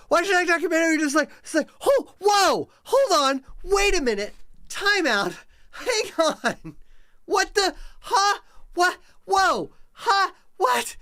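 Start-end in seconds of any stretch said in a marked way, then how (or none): 1.8-11.0 high, loud, frantic stammering; a string of high, stunned, hammed-up double-takes; panicked confusion. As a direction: flail for words like the floor just dropped. Reenacting panicked confusion at the news.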